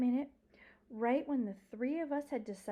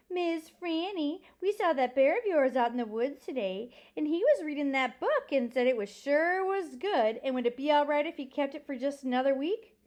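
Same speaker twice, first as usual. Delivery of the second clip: loud, higher mock-earnest teasing; teasing townsmen; 6 semitones higher, 8 dB louder than usual. Men in town ribbing the librarian about her bear, teasing. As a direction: draw out the name, then tease with exaggerated emphasis.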